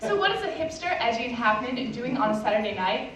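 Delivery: ordinary delivery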